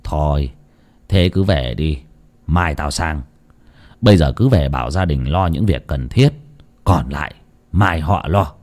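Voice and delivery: low, softened, subdued elder; quietly resigned; sighing resignation. A narrator's reading. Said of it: "đoạn ông thở dài".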